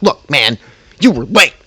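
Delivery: loud, punched mock reprimand; imitated yelling. Coworker scolding him for lateness, mock yelling. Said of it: "keeps yelling at me".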